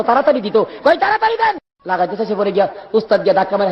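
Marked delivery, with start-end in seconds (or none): 1.0-1.6 very high, insistent cry; urgent demand. Acted. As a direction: call out impatiently at a high pitch. Same request acted as urgent cry. (খুব উঁচু গলায় ব্যাকুল তাগাদা)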